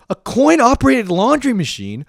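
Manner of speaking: very high, loud, panicked disbelief; freaking out. Retold or imitated. New student told to use coin-operated laundry, freaking out. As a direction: spiral into overwhelmed, squealing bafflement. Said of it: "I just start freaking out".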